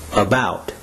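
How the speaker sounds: "About" with the weight on "bout"; light "A", accented "bout" in "About"; second-syllable stress. Shown as a demonstration. Teacher modelling word stress, "About" stressed on its second syllable. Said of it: "second syllable is to be accented".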